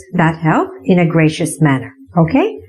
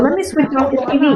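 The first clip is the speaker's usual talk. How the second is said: voicing the helper; 6 semitones higher, much faster than usual. Shown as a demonstration. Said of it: "As, uh".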